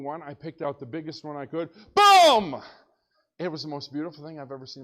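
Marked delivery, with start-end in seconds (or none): 1.9-2.6 a loud high mouthed rifle blast; gunshot boom. Retold or imitated. Pastor imitating his rifle shot to the congregation, loud boom. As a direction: blast it out like a gun going off.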